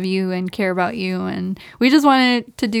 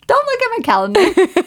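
much higher freaked-out plea; panicked squeak; 11 semitones higher than usual. Retold or imitated. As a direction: blurt it in a high panicked voice. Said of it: "I was like".

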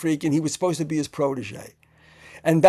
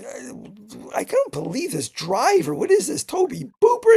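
mocking; 10 semitones higher than usual. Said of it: "Tobe is all of a sudden saying to me".

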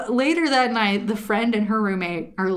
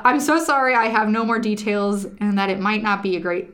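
usual speaking voice

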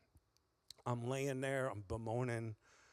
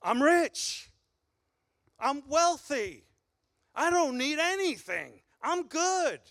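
smug boast; 9 semitones higher, 5 dB louder than usual. Retold or imitated. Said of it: "he says to them, "You say".

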